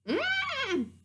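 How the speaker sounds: high, drawn-out imitated elephant call; elephant trumpet. Storyteller guessing animal noises for kids, elephant call. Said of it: "You are Eloise the elephant".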